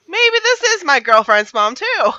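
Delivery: high, loud outburst of realization; climbing, near-shouted revelation; loud exclamation. Acted. Acted shock at possible coincidence.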